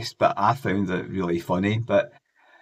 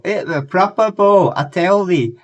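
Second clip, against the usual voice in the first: much higher, louder exaggerated [name] accent; 11 semitones higher, 10 dB louder than usual. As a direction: overdo the [name] accent, high and loud. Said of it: "exaggerates his, like, accent".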